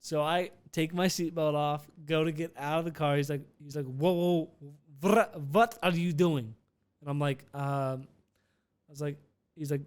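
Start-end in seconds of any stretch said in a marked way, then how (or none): 3.8-6.5 imitated Russian driver, slow and emphatic; Russian accent. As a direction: object in a put-on Russian accent. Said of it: "I gotta find my Russian accent".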